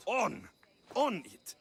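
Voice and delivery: correcting the line emphatically; loud correction. Shown as a demonstration. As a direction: snap back the word On.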